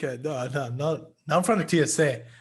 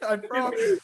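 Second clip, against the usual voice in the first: laughing; slower than usual. Real, not acted. Laughing over brother's call.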